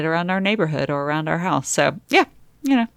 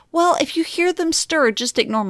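mocking video advice; 11 semitones higher than usual. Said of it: "they were like".

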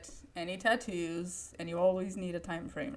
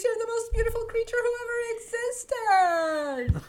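gushing mom; 8 dB louder than usual. Retold or imitated. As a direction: overdo a mother's fake praise. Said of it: "Oh my".

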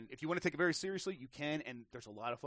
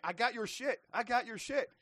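higher and louder, gloating taunt; mock triumphant yell; 9 semitones higher, 7 dB louder than usual. Teammate retelling a prank, yelling a taunt at enemies. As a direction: shout it like you just stole their car. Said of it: "It's like".

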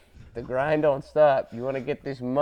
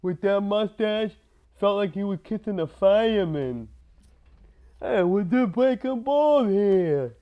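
mobster voice; 5 semitones higher than usual. Pretend. Mobster quotes continue.

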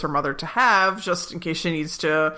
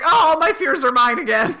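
higher and louder burst of relief; joyful exclaim as the spell breaks; relieved; 8 semitones higher, 6 dB louder than usual. Retold or imitated. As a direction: Chidi thrilled his fears came back. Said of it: "Chidi's like".

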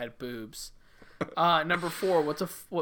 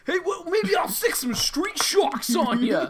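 monster truck ad; 13 semitones higher than usual. Retold or imitated. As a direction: yell it like a monster truck rally ad. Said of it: "That's a pretty good Monster Truck voice".

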